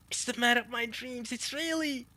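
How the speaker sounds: high, lovestruck mock female voice; swooning woman. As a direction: swoon over the dream man.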